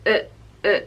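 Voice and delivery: uh from the throat, mouth still; lazy throat uh; relaxed schwa uh. Shown as a demonstration. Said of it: "the sound is uh".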